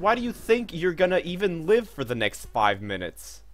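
high, punchy taunt at the enemy; indignant trash talk. Real, not acted. Gamer to a boss after beating Artorias, indignant. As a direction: fire back with cocky outrage.

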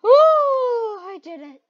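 loud, high, drawn-out cheer; elated celebration; triumphant whoop. Real, not acted. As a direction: whoop with victory. Celebrates beating the level.

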